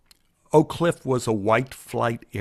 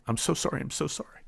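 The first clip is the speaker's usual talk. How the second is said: tearful apology; 2 semitones higher, 8 dB quieter, faster than usual. Retold or imitated. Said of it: "I was in tears".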